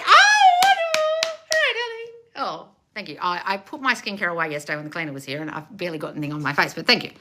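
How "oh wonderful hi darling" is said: very high, slow, drawn-out gushing; sing-song gush. Pretend. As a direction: coo it like a delighted auntie. Reacting to a viewer compliment.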